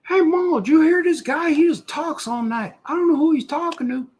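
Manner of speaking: high mocking heckler voice, teasing the host. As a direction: squeak it out like a heckler in the chat.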